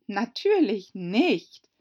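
high, loud, drawn-out denial; indignant. A narrator's reading. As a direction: reject it slowly and huffily. (indigniert, gedehnt und laut)

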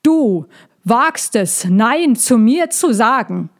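loud, high, imperious dragon elder; drawn-out, indignant opening rising into outrage; thundering outrage. A narrator's reading. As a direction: thunder it, affronted at being defied. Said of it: "durch ihre Donnerstimme".

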